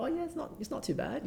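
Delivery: put-on broad Australian accent, pitched well up; playful Australian accent, answering the visiting designer. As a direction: lay on the Australian accent, casual and offhand. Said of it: "speaking my fairly Australian accent".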